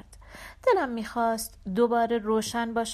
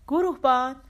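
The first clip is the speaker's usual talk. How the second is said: loud, higher call for someone missing; 4 semitones higher, 7 dB louder than usual. Acted. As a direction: call out into the dark. Calling out for the sergeant. (صدا زدن با صدای بلند)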